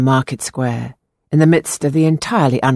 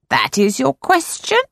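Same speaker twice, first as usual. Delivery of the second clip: fortune teller; 10 semitones higher than usual. A narrator's reading. Fortune-teller voiced in story.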